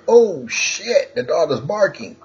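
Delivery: high-pitched startled outburst; mock alarm. Speaker mimics listeners hearing the dog bark, mock alarm. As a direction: act out sudden alarm, voice jumping high. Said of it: "You're like".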